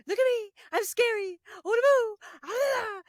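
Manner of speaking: high, loud hammed-up Homer; Homer acting scary. Friend retelling Homer's antics with the bat in a high, loud hammed-up voice.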